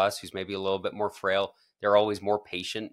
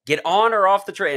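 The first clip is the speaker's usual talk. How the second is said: loud, high, fed-up order; stern driver shout; 10 semitones higher, 7 dB louder than usual. Retold or imitated. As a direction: bark it over the intercom. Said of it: "and he says".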